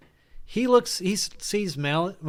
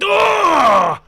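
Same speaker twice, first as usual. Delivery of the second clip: high, loud cry of pain; pained yell; 14 dB louder than usual. Acted. Game master voices enemy hit by thrown dagger, pained yell. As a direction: yell out as if struck. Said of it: "and he yells out".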